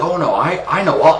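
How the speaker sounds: high-pitched mock know-it-all; mocking imitation. Ranter mimicking gym guy who argues back, mocking. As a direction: parody a flustered insistence on expertise.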